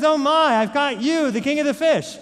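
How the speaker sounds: amazed high loud cry of discovery; a fisherman shouting in disbelief at his catch; excited fisherman. Acted. Fisherman marvels at catching the king fish, excited loud cry.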